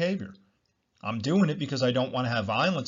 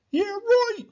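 much higher, mocking "yeah, right"; sarcastic scoff; 5 dB louder than usual. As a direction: scoff at the idea in a squeaky mock voice. Mocks imagined listener's reaction.

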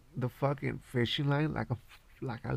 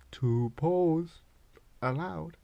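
smug; 4 semitones higher, slower than usual. Retold or imitated. Telling officer to check license.

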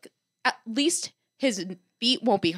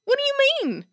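incredulous. Real, not acted. Indignant at vet.

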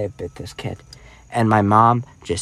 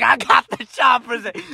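put-on proud kid; 10 semitones higher than usual. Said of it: "I was like".

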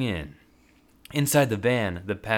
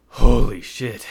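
louder burst of relief; relieved exclamation; 2 semitones higher, 11 dB louder, slower than usual. Acted. Devin exclaiming in relief as sirens fade. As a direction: let it out.

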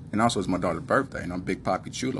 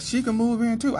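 put-on much higher, a bright eager kid voice; daughter's eager voice; 3 dB louder than usual. Daughter inviting mom too, in a much higher eager voice. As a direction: play the daughter offering happily.

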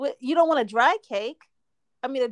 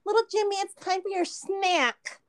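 annoying mom voice; 8 semitones higher than usual. Retold or imitated. Mocking how some people call a child to snack, in a sing-song voice. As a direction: drag out the snack like a cloying parent. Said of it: "if they emphasize the act, it's so annoying".

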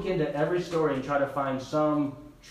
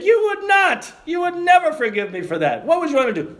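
high, shouted, emphatic contradiction; loud disbelief; 12 semitones higher, 8 dB louder than usual. Retold or imitated. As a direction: challenge them at full volume, pitch leaping up. Preacher disputes pious claim of forgiveness.

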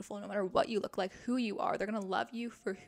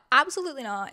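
ordinary talk, nothing marked

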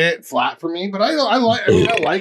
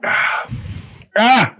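wincing from an ice-cold sip; pained yelps from the cold beer; cheeks aching from cold; 8 semitones higher, 3 dB louder than usual. Real, not acted. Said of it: "it hurt my cheeks. It's so cold".

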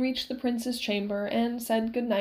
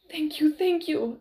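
breathless thanks; 6 semitones higher, 3 dB louder than usual. A narrator's reading. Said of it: "Inigo said".

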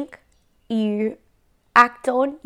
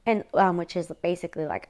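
ordinary talk, nothing marked

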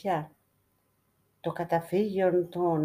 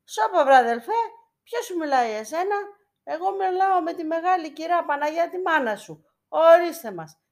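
much higher and louder, indignant; angry outburst; 11 semitones higher, 4 dB louder than usual. A narrator's reading. The beggar angrily scolds the icon of Christ. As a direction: brush the voice off in a huff. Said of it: "Θύμωσε τώρα ο Λεημονής".

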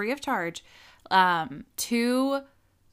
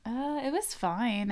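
ordinary talk

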